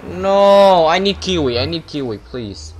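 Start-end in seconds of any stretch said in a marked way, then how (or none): none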